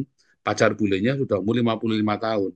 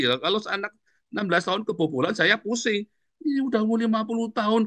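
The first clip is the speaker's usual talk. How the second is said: high, worked-up comic disbelief; exasperated; 12 semitones higher than usual. Real, not acted. Pastor joking to congregation about old lovers, high exasperated. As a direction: throw up your hands, pitch up.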